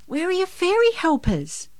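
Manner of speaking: put-on high little boy voice; curious child. A narrator's reading. Boy asks Santa about his helpers, curious. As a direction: ask high, like a curious kid.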